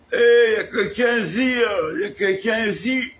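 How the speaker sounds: high, loud call to an empty room; high searching holler. Acted. Acted arrival, calling for someone.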